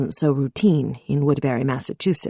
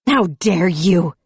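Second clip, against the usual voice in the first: slow, weighty outraged accusation; 2 semitones higher, 4 dB louder, slower than usual. A narrator's reading. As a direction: lay every word down in fury. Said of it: "her anger like lightning".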